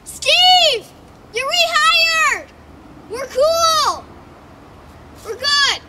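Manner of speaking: loud, high call across the park; calling out loudly. Acted. Boss shouting to Steve that he is rehired, loud and high. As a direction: shout it out to him far away.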